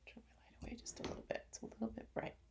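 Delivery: soft whisper to a helper; whispered aside. Real, not acted. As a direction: whisper it off to the side. Aside to helper about light.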